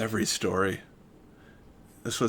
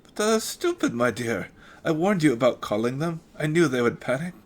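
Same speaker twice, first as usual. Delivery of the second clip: put-on louder, higher German-accented protest; indignant German doctor; 5 semitones higher, 5 dB louder than usual. The doctor scolds Helga, German-accented indignation.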